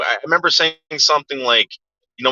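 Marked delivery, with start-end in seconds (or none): none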